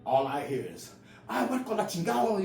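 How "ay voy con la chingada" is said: high-pitched imitation of mom cursing; cussing in Spanish. Mom cursing at her son through screen door, high Spanish cussing. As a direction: mimic an angry mother swearing in Spanish. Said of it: "just starts cussing in Spanish".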